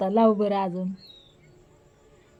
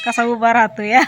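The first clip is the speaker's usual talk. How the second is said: usual speaking voice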